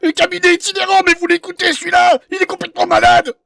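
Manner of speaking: high, loud indignant tirade; outraged shouting. Acted. Patient mocks the travelling practice, outraged shouting. Victim scoffs at the fake practice.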